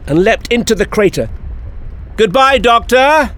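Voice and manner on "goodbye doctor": high, drawn-out gleeful shout; joyful yell. Acted. Finfalaflix bids the doctor goodbye as he leaps, joyful yell. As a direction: yell a triumphant farewell with sheer joy. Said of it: "yelled with unalloyed joy".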